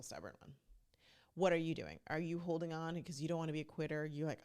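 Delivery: usual speaking voice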